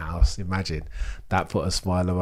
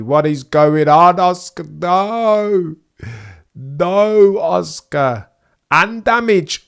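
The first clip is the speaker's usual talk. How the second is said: anguished shouting; 10 semitones higher, 11 dB louder, much slower than usual. Real, not acted. Piastri crashes into the barrier.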